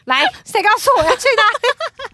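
high, loud mock tantrum demand; childish tantrum. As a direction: stomp it out like a sulking kid. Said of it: "小孩子脾气".

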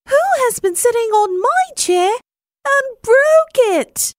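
very high, quick little cub voice; teeny tiny voice. A narrator's reading. Little bear finds his chair broken, teeny tiny voice. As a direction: squeak it out as the tiniest bear. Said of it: "in a teeny, tiny voice".

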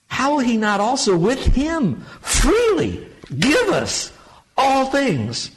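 high and loud, slowed preaching peak; soaring sermon crescendo; rising preacher fervor. Acted. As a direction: lift the congregation on all things. Preaching climax on the verse.

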